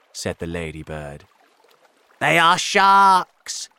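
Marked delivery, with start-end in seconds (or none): 2.2-3.7 loud, high, drawn-out wail; alarmed cry. A narrator's reading. Earthworm warning the others of sharks, alarmed cry. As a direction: cry out a dreadful warning slowly. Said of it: "cried the earthworm".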